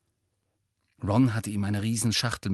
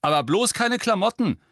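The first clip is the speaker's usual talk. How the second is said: high, loud alarmed warning; urgent warning; 11 semitones higher, 7 dB louder than usual. A narrator's reading. Ron warns Hermine about her gift, loud and urgent. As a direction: warn her off, raised and insistent. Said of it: "warnte sie Ron".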